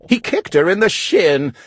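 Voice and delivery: usual speaking voice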